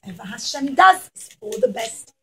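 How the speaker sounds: plain reading voice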